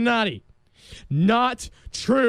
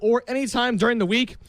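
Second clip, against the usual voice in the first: ordinary delivery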